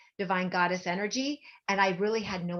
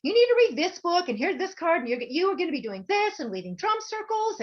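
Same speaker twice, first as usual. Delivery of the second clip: higher, louder instructing voice; high bossy psychic; 10 semitones higher, 4 dB louder than usual. Retold or imitated. The psychic instructing her, high and bossy. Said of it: "She's like".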